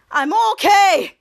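loud, stretched-out announcement; slow and loud, imitating the character; retold triumphant call.

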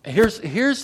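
usual speaking voice

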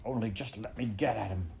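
muttered, held-in fury; angry mutter. A narrator's reading. Toad overhearing the mockery, muttering angrily. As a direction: mutter it through gritted teeth. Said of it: "muttered Toad, grinding his teeth".

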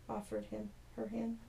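softly rereading the fixed line; quiet self-correction. Real, not acted. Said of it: "Offered her hand".

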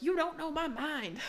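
high-pitched put-on protest; imitated comic comeback; Critter's retort. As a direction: push it up high, mock defiant. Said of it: "And Critter v-, eventually is like".

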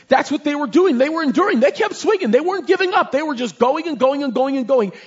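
preaching climax, voice lifting high; fervent climax. Real, not acted. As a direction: build it to a peak.

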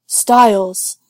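loud shout of a name; yelling. A narrator's reading. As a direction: yell it through a closed door. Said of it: "Derek yells through the door".

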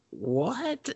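high, soft, incredulous question; retold disbelief. Viewer reacting to the absurd mountain chase, mock disbelief. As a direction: let it climb in baffled disbelief.

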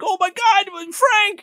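mock panic. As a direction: cry out his name in horror. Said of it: "his friend's like".

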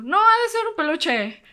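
high, loud mock reassurance brushing off a noise; falsely calm policewoman. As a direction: play it down, voice pitched high and bright with forced calm. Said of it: "una dijo".

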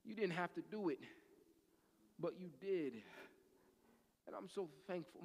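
soft, low acted prayer; hushed private prayer. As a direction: pray quietly, pausing between phrases. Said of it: "you just say".

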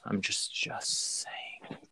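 whispered, trailing off; sly whisper. Real, not acted. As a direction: let it slip out as a whisper. Sly aside at the end.

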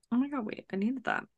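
ordinary delivery

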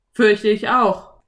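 loud, slow cowboy drawl; dry cowboy agreement. A narrator's reading. (Cowboy, laut und langsam)

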